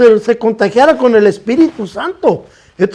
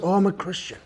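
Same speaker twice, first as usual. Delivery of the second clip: soft, low murmur; real low voice; 2 semitones lower, 14 dB quieter than usual. Retold or imitated. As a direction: say it quietly, embarrassed. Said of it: "he tells me in a real low voice". (voz muy baja y apenada)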